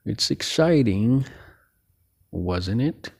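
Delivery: slow, badly faked little sage voice; a half-hearted go at a wise alien, drawn out; horrible Yoda. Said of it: "that was a horrible Yoda impression".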